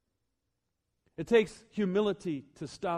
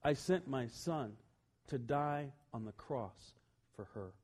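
slow, soft and low, voiced rather than whispered; hushed, lowered voice, slowing with weight; God's gentle whisper; 3 semitones lower, 7 dB quieter, slower than usual. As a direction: speak softly and slowly as God confiding. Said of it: "he whispered this in my ear".

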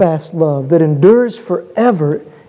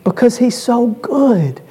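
raised pitch, drawn-out sermon cadence; impassioned, higher preacher voice; fervent preaching; 5 semitones higher than usual. Real, not acted. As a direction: build praise with earnest conviction. Preaches with fervor.

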